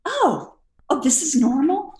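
high, shaky-sounding mock worry; nervous question. Nervous flyer asking the attendant, high mock worry. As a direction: ask hopefully, still scared. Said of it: "I'm like".

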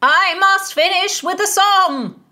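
high, slow bellow; loud insistence. Acted. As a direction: proclaim it at full volume. Bellowing lord insists on continuing.

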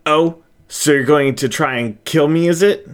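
cornered squirrel shouting back at the shadows, defiant; defiant challenge. Acted. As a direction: dare the voice to try it. Squirrel challenges unseen foe.

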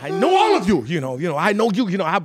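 high, loud, laughing take on the professor's speech; mock-orate the big monologue; hammed-up tragic speech; mock grand monologue. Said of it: "Vaughn was, like, going".

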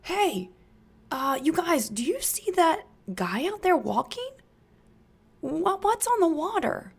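calling out amazed. Acted. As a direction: point and call, half unbelieving. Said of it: "yells to the others".

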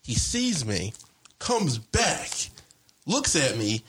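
usual speaking voice

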